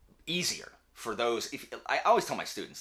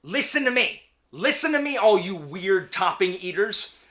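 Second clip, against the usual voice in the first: much higher and louder, insistent; passionate rant; 12 semitones higher, 9 dB louder than usual. Real, not acted. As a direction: lecture the weird topping eaters hotly. Mock rant against pizza toppings.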